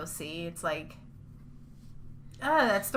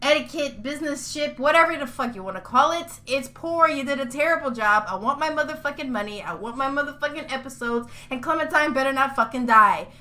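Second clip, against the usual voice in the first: higher-pitched, furious ranting; mad tirade; 3 semitones higher than usual. Real, not acted. Fan berating Telltale for poor business, mad tirade. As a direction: let the outrage pour out in a tirade. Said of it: "I'm so goddamn mad".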